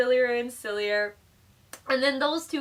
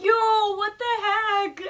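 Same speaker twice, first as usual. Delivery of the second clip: high, loud, stunned disbelief; shocked yo; 9 semitones higher, 6 dB louder, much slower than usual. Retold or imitated. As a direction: react like you can't believe it. Friend shocked at Chip quitting.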